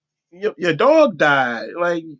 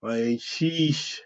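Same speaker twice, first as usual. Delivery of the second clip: low, soft deflated groan; a mock-mopey sigh over hot wives; droopy sigh; 8 semitones lower, 7 dB quieter, much slower than usual. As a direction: sag like a sad sack. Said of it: "I'm gonna be like".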